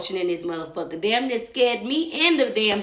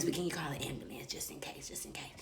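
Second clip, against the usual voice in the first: hushed urgent request; whisper; 4 semitones lower, 14 dB quieter than usual. Retold or imitated. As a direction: whisper so no one hears. Whispering to manager, avoiding scene.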